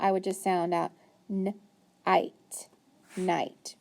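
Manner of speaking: slow n-ite segmented, then night blended; teacher modelling sounding out. Shown as a demonstration. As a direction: break the sounds apart, then join them. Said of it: "when I sound it out".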